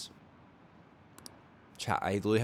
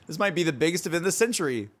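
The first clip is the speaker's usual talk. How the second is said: ordinary talk, nothing marked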